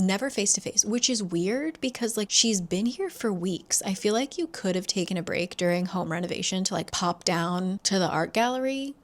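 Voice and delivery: usual speaking voice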